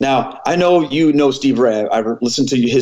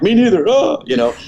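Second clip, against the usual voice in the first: high-pitched, hammed-up tearful wail; comic imitation of blubbering; mock crying; 11 semitones higher than usual. Two grown men crying in the office, mock tearful. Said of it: "We're both in there crying".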